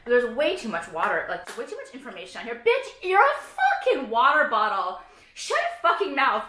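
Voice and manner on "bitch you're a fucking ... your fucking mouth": slow, louder mock scolding of a bottle; mock angry rant. Real, not acted. Yelling at her water bottle, mock furious. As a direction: tell the bottle off, drawn out.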